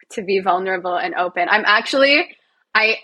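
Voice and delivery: usual speaking voice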